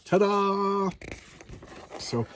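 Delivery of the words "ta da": high showman's ta-da, a touch hammed-up; mock ta-da. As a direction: present it like a stage magician's reveal. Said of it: "And he's like".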